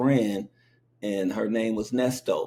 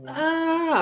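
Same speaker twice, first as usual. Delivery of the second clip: very high mock child's wail. Toddler learning her cow was eaten, a high mock wail. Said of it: "I started crying".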